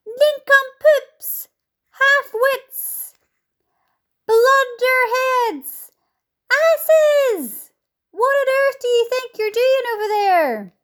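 high, loud, drawn-out yells; insulting yells. A narrator's reading. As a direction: hurl each insult across the sky. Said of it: "he yelled".

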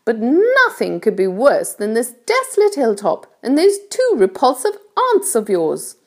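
high-pitched storybook ladybird voice; kindly ladybird. A narrator's reading. Ladybird explains things to James, kindly high voice. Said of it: "said the ladybird".

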